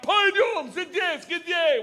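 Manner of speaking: high loud Russian bark; barked order. Retold or imitated. Police officer ordering him over, a high loud bark. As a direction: bark it like a cop. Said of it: "hear him bark out".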